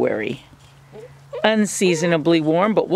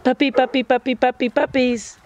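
higher sing-song puppy call; calling a dog; 5 semitones higher than usual. Real, not acted. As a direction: coax the pups over playfully. Calling pups again.

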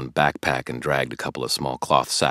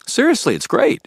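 much higher, eager push; insisting; 12 dB louder than usual. Acted. Billy talking Harry into the game night, eagerly. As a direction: sell it hard, keen. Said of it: "he insisted".

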